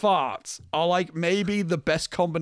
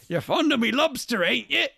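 higher-pitched mock film-character line; film quote impression; 6 semitones higher than usual. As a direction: imitate an aggrieved old film character. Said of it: "[name] just goes".

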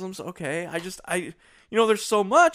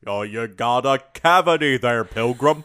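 a light John Wayne drawl; lightly voiced cowboy dentist; 2 semitones lower, 6 dB louder, slower than usual. Imagining the dentist as John Wayne, a light cowboy drawl. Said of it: "John Wayne, your dentist?".

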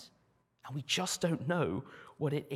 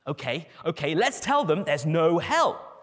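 louder, eager pitch; eager demon; 8 dB louder than usual. Acted. Second demon excited idea.

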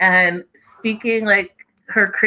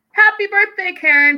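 high, loud sing-song greeting; cheerful birthday wish; 12 semitones higher, 5 dB louder than usual. Real, not acted. Vlogger greets Karen on her birthday, high and loud. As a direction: call out happy birthday with delight.

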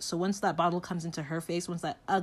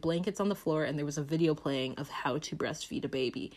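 normal talking voice

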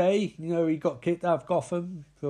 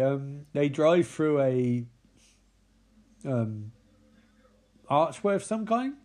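usual speaking voice

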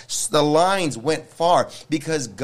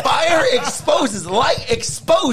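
fiery shouted preaching; 9 semitones higher, 4 dB louder than usual. Real, not acted. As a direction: preach it at full force, climbing high.

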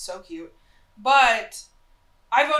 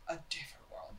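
whispering; 5 semitones lower, 16 dB quieter, faster than usual. Real, not acted. Whispered aside.

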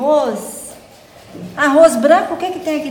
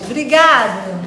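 usual speaking voice

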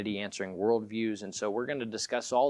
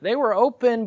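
high, loud rising cry of "open"; excited preaching burst; 12 semitones higher, 12 dB louder than usual. Acted. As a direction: lift it up with sudden fervour. Preaching peak, pitch jump.

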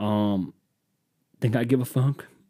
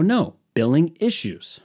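slow, higher insistent correction; exasperated caller; 6 semitones higher, slower than usual. Retold or imitated. Caller repeating himself to a phone robot, slow and exasperated. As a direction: spell it out for a dense phone robot.

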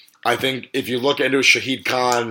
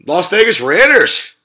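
hyped call; 7 semitones higher, 9 dB louder, slower than usual. Acted. Hyped call of team name.

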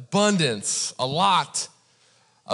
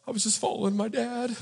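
high, quick, sheepish plea; mock meek excuse; 8 semitones higher, 3 dB quieter, faster than usual. Young driver excusing himself to officer, mock meek. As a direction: plead innocence in a small high voice.

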